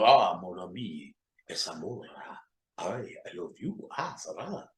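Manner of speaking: hushed, breathy fake French accent, seductive; fake French seduction. Seducing a woman with fake French accent whispers. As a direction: purr fake French words like a lover. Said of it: "I talk that fly Frenchish shit".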